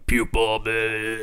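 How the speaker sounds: loud, lightly rasped fake drill voice; faked frog voice. Young drill instructor faking the voice, a loud forced rasp. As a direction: force a gravel you don't have. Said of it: "to fake it".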